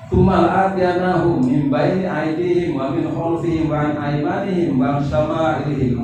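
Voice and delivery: slow, chanted Quranic recitation. Acted. Preacher recites the verse to the congregation, chanting. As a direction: intone the verse with weight.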